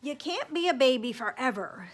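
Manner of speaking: loud, stern busybody; scolding neighbor. Acted. Neighbor telling parents Owen is too old, loud and stern. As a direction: scold them firmly.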